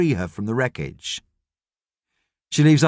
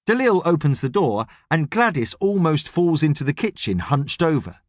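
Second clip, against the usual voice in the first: ordinary delivery, nothing marked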